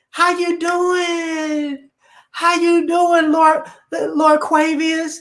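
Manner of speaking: high, slow, fawning falsetto woman; gushing mock woman. Mocking a woman who now wants the lawyer, high and gushing. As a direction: swoon over the lawyer in a high, drawn-out voice.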